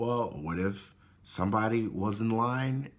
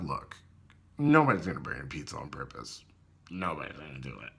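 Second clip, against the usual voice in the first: calm manager; 7 semitones lower than usual. Retold or imitated. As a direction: settle it coolly like the manager. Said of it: "he's like".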